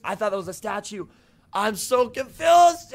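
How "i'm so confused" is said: loud, high, drawn-out bewildered shout; confused outburst. Real, not acted. Streamer baffled by a guy with a chicken, confused shout. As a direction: cry out in exasperated confusion.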